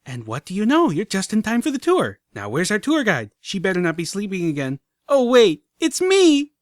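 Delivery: high, loud nonstop excitement; a filly playing tour guide; breathless chatter. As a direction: rattle on without a breath. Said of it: "didn't even stop for breath".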